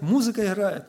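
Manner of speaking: usual speaking voice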